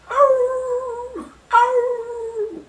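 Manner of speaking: high, yelping dog howl; a bad but earnest impression of a dog howling. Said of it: "I'm not a very good, uh, impersonator of a dog".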